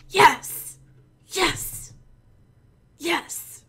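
hammed-up, fading cries of yes; giddy yeses. Award winner savoring the win in high, fading yeses. Celebrating employee of the month win.

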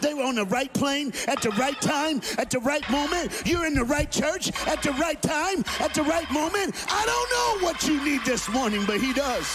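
loud, high, rising preacher's call; preaching at full force. Acted. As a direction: drive it home to the congregation.

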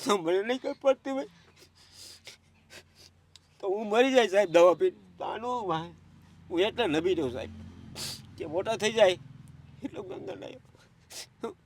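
tearful, voice breaking high and loud; sobbing out his despair; real crying, words cracking into sobs. Real, not acted. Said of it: "પેલા તો તમે રડો નહીં".

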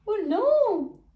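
high, loud cry of alarm. A narrator's reading. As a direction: cry out as the wheels slide. Percy skids into factory.

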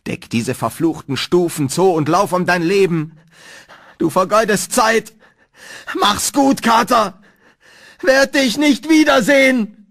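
urgency mounting to full scream; rising to scream. A narrator's reading. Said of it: "steigerte sich Warrens Flüstern zu einem Schrei".

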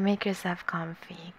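whispered and soft; a real whisper to the viewer; cozy whisper. Real, not acted. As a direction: hush it like tucking someone in. Welcoming a viewer in.

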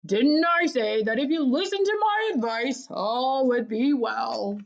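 high, swelling cry that slows grandly at the end; triumphant. A narrator's reading. Longworth claiming credit before the family, triumphantly. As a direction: crow it, drawing out the final words. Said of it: "Longworth cried triumphantly".

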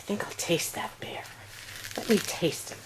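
whispered, low teasing aside; playful whisper. Pretend. Grandparent teasing the baby about tasting the bear, whispered. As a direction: whisper it like a secret game. Pretending to eat the toy bear.

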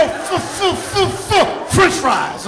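slow stammer f- f- then French fries; stuttered guess. Retold or imitated. Helping a stutterer guess the word, slow stammering f-. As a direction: drag the f- sounds out. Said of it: "you start guessing at the word".

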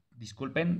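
usual speaking voice

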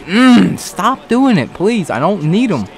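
whiny pleading. Real, not acted. Pleading with game prompts.

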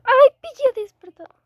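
high, loud startled cry; alarmed. Real, not acted. Piggy wakes, alarm. (grito alarmado)

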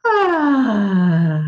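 a long open "ah" breathed out; yawn sigh. Shown as a demonstration. Naming the yawn sigh for the group, breathy sigh. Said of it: "let's call it a yawn sigh".

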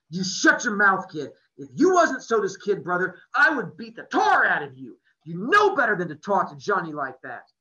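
loud, stern rebuke; older friend scolding the kid for his cruelty; angry scolding. A narrator's reading. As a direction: put the kid in his place. Two-Bit scolding Ponyboy.

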